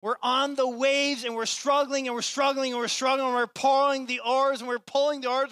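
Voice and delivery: high, loud, pounding repetition; fiery preaching. Acted. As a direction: preach it at full force, driving each line. Preaching at full force.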